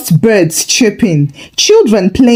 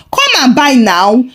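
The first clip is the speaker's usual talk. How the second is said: usual speaking voice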